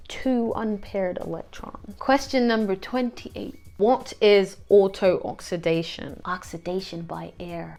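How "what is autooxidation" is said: usual speaking voice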